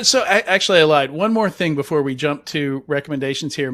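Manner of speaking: ordinary talk, nothing marked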